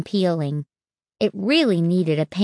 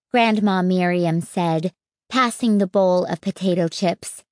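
plain reading voice

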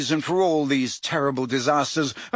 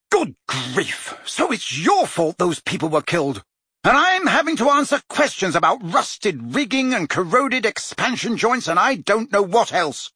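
furious Prime Minister; 6 semitones higher than usual. A narrator's reading. Prime Minister blames Fudge, building furious. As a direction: let the outrage build. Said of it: "said the Prime Minister furiously".

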